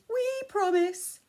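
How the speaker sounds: sneaky goblins pretending to agree; giggling goblins. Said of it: "giggling and nudging".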